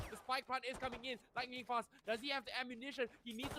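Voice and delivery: high, energetic live play-by-play; esports caster. Acted. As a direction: call the action as it happens.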